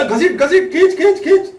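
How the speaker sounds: high, loud urging to pull; urging shout. Acted. Guru urges disciple to pull the fish, excited high shout. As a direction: cheer him on to yank the line.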